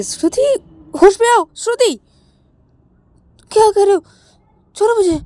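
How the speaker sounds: high, loud cries to snap her out of it; frightened shouting. Acted. Shreya shakes Shruti by the shoulders, shouting in fear. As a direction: shake her awake with your voice. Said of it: "हिलाने लगती है कि".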